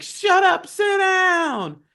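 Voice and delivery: high-pitched yell; high, acted-out jeer; mock heckler shout. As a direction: shout it like someone up in the rafters. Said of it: "if they were like".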